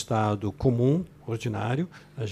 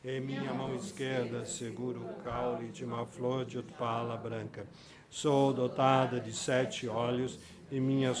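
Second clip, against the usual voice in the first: soft, slow, even recitation of a sadhana; meditative text reading; 7 dB quieter than usual. Acted. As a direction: read it as a quiet visualization. Sadhana read aloud to group.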